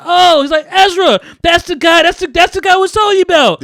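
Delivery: high, loud, thrilled pointing out; light up at the dealer's arrival; excited recognition. Retold or imitated. Friend excitedly introducing the coke guy, high and loud.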